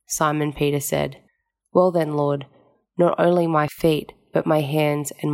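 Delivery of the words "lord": normal talking voice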